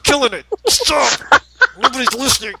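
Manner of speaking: high, loud, hammed-up pleading; mock panicked outburst. Co-host joking to Dee about losing listeners, mock panicked outburst. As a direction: call it out in pretend panic. Acts out herself panicking as joke.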